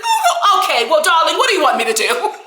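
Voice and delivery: imitation starting high, falling to everyday pitch; dropped cry voice. Host mimics Dorit answering Kyle, cry voice suddenly dropped. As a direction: drop the fake tears mid-sentence. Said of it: "She dropped that cry voice so quick".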